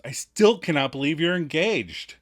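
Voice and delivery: mock disbelief. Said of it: "And Phoebe claims".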